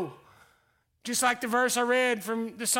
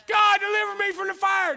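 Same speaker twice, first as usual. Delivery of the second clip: much higher, louder cry for rescue; pleading shout; 8 semitones higher, 5 dB louder than usual. Acted. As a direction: beg God to pull you out. Acted plea to God.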